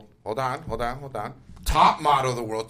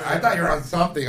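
normal talking voice

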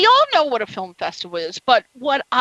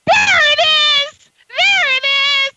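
delighted squeal; 5 dB louder than usual. Real, not acted. Reacting to guest's accent.

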